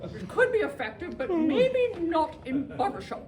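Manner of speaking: high, loud, plummy hooting with lifted palate; Julia Child parody. Shown as a demonstration. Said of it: "sing like Julia Childs or Sean Connery".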